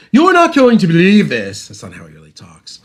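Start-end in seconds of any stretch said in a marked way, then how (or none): 0.1-1.7 high, loud hammed-up British accent. Retelling a repairman's midnight reply, mock British accent. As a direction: put on an exaggerated British voice. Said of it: "in his British accent".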